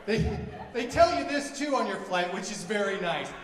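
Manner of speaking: normal talking voice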